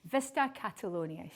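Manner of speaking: normal talking voice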